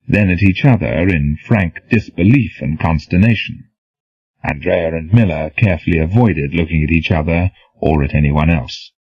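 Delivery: ordinary delivery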